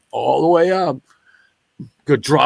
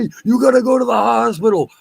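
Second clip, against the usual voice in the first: urging stranger; 10 semitones higher, 3 dB louder than usual. Retold or imitated. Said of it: "This one dude said".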